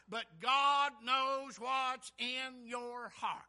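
loud, rising preacher's cadence; preaching at full force. Acted. Preacher to his congregation, preaching at full force. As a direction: preach it loud, building to the healed man's cry.

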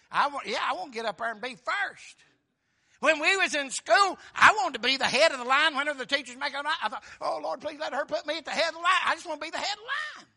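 loud, high, rushing reenactment; excited preaching. Retold or imitated. Preacher recalling wanting to lead the school line, excited preaching. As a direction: tumble out an eager schoolboy's plea.

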